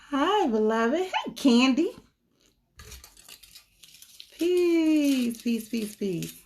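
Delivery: high sing-song hello, easing down on the last word; bright greeting. Real, not acted. Reader welcoming Candy and others into the stream, high sing-song. Greeting viewers joining the live.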